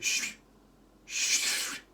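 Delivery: long unvoiced "shh"; shushing. Girlfriend hushing the hero before he says it, shushing. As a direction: hush them with two drawn-out shushes.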